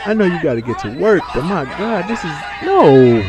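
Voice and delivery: loud laughing rant; laughing disbelief. Real, not acted. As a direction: shout through laughter. Laughing at game character's stupidity.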